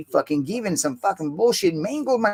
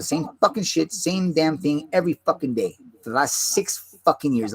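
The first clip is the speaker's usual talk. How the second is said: ordinary talk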